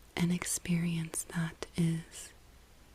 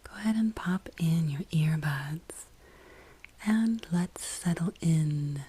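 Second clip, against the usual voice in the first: guided meditation; 3 dB louder than usual. Real, not acted. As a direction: ease the listener into the breath, unhurried, with long pauses.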